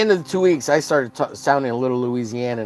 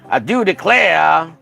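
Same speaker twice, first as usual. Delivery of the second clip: slow, loud southern drawl; hammed-up southern drawl, stretched and louder; 5 semitones higher, 7 dB louder, slower than usual. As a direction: draw it out like a Southerner. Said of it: "a nice southern drawl".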